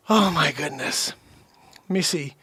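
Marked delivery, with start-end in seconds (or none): none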